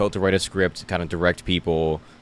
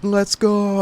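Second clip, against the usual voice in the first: high, loud triumphant cheer; 5 dB louder than usual. Retold or imitated. Fan at Yankee Stadium after catching the home run, excited cheer. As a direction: celebrate the catch. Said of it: "I was like".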